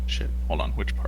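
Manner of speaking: whispered aside to self; hushed, muttered aside; whispering. Real, not acted. Checking his notes mid-scene.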